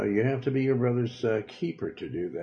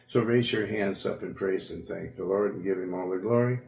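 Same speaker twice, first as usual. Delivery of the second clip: ordinary delivery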